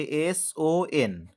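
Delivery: normal talking voice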